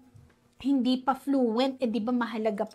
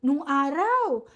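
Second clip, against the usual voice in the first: put-on 1 preachy old folks; 5 semitones higher, 3 dB louder than usual. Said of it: "yung sinasabi natin sa kanila lagi".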